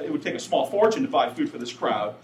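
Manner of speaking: ordinary talk, nothing marked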